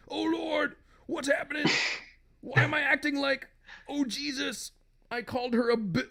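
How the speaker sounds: high, anguished comic husband; husband's voice. Acted. Husband horrified at what he called her, high anguish. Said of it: "Oh, no, this is him".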